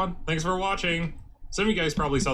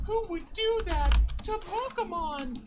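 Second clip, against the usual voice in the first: mock outrage; slower than usual.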